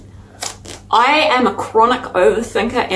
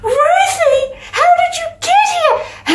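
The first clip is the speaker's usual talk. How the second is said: much higher, half-laughing cry of surprise; delighted surprise; slower than usual. Retold or imitated. Friend spotting Ruthie at the library, high delighted cry. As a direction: shriek her name in joyful shock.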